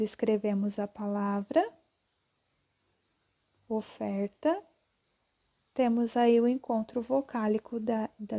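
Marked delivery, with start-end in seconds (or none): none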